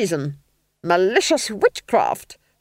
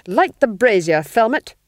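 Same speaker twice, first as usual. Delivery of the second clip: loud, imperious command; snapping Duchess; 5 semitones higher, 8 dB louder than usual. A narrator's reading. Duchess ordering the Duke, loud snapped command. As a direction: snap the order in a raised voice. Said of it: "snapped the Duchess".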